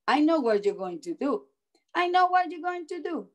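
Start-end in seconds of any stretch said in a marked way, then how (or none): 1.9-3.3 put-on high, quick child voice; knowing child. A narrator's reading. Andrew tells the dentist he knows, high quick child voice. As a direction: rattle it off high, like a kid who has guessed. Andrew's line repeated, higher.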